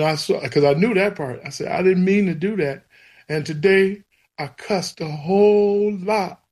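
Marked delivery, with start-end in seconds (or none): 1.7-6.4 pleading prayer, pitched higher, voice near tears; a child's sobbing apology to God; retold crying prayer. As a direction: beg the Lord's forgiveness through tears. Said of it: "I'm crying and I'm telling him".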